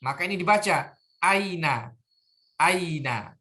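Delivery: "aina" with a clear glottal onset and full a; hamzah read. Shown as a demonstration. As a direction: say "aina" starting crisply on the hamzah. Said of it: "ada kepala ain di atasnya". ("aina" dibaca jelas dengan hamzah di awal)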